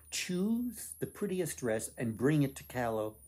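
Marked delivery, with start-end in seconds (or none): none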